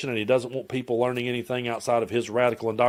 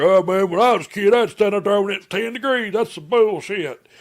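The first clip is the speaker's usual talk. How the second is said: higher, louder put-on grumbling local voice; mocking locals; 8 semitones higher, 4 dB louder than usual. Locals complaining about snow days, mocking high grumble. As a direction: sneer it like a cold-weather tough guy. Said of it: "we'll have people around here".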